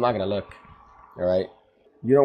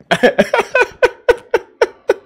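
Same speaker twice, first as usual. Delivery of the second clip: much higher, louder laughing fit; shrieking laugh; 11 dB louder than usual. Real, not acted. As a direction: crack up completely.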